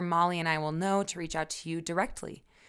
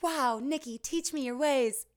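higher, gushing mock awe; awed family member; 8 semitones higher, 4 dB louder than usual. Family member amazed by you, higher mock awe. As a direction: play the dazzled relative. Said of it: "and be like".

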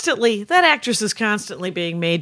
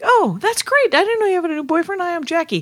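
high, bright retold hello, quickened; perky green-room hello to a stranger; chipper greeting; 8 semitones higher than usual. Greeting Sarah's new boyfriend, bubbly and much higher. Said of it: "And I was like".

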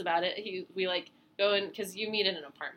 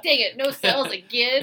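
mock frustration; 5 semitones higher, 10 dB louder than usual.

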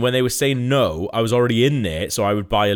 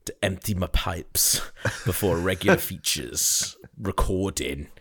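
slow ad voiceover; 3 semitones lower, 4 dB quieter, slower than usual. Pretend. Spoof ad read.

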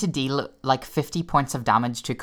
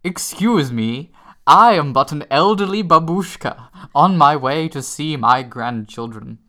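put-on old babushka voice; 2 semitones higher, 7 dB louder than usual. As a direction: play a frail elderly babushka, loud. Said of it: "my favorite moment was".

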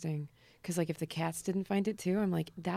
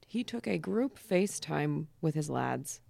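ordinary talk

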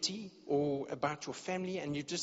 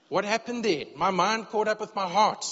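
louder, higher, emphatic punchline; emphatic explanation; 4 semitones higher, 6 dB louder than usual. Retold or imitated. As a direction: proclaim it like a revelation. Husband explains tears to wife.

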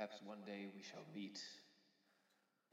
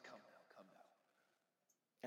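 whispering; 8 dB quieter, much slower than usual. Acted. Ferryman coaxing softly.

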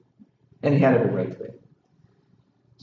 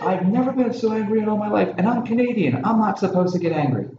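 higher-pitched, hammed-up outrage; imitating a furious producer losing it, pitched up; mock angry scream; 10 semitones higher than usual. Producer yelling at the crew in the heat of battle, mock furious. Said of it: "He goes".

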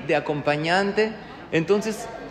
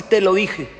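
told you so; 3 semitones higher, 5 dB louder than usual. Retold or imitated. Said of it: "No decir".

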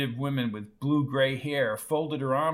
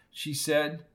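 usual speaking voice

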